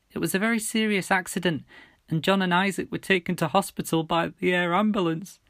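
fighting tears while telling; breaking bad news. A narrator's reading. Mum breaking tragic news.

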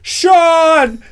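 high, loud repeated desperate calls; frantic yelling. Retold or imitated. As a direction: yell the name over and over, panicking. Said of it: "you see the guy going".

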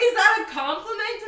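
high, loud question through laughter; laughing disbelief. Retold or imitated. Friend retells questioning her date, laughing disbelief. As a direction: ask it incredulously while cracking up.

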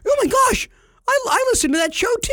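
squealing high, loud, fast starstruck fan; excited fan voice. Pretend. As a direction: gush like a giddy fan who spotted you. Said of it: "When somebody goes".

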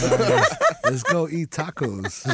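slow quote through laughter; amused, laugh-filled mock reply. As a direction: retell it while cracking up. Laughing through own retold quote.

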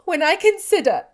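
high, loud and agitated. Acted. Elizabeth blaming herself to Darcy, loud and agitated. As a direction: blurt it out in rising agitation. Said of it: "she added in a yet more agitated tone".

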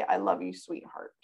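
soft, fading tender words. Retold or imitated. Mother softly telling toddler she loves her. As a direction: soothe a toddler gently. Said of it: "I'm gonna say".

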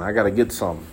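low, soft, trailing mock hunger; mock craving. Playing bugs eyeing raw booty, mock craving. Voicing insects craving the speaker.

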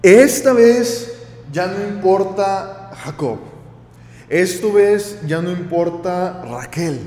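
slow, loud declaration with stretched words; emphatic resolve. Acted. Preacher voices Lea letting go of Jacob and Raquel, slow and emphatic. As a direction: punch each opening, then draw it out.

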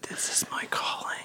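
very soft, breath-only whisper; mock ASMR whisper. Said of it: "making A S M R videos".

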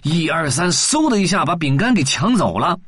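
ordinary delivery, nothing marked

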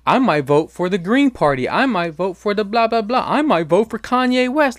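mocking smug voter. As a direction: ham up a self-righteous voter to ridicule them. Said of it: "gonna be like".

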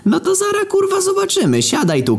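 very high, hammed-up outburst; threatening cop. Old cop ordering the narrator to sit, high mock fury. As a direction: order him down in a high put-on voice.

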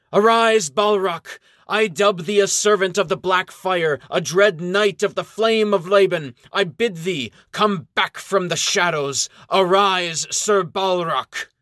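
high, loud ceremonial proclamation; solemn regal knighting. Acted. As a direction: command him to rise like a queen. Princess knights the undead giant.

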